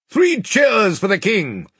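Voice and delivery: high, loud, drawn-out call for cheers; rally the soldiers with a long, high cry. A narrator's reading. Byrne calling the soldiers to cheer the king, rallying cry. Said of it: "Byrne immediately cried".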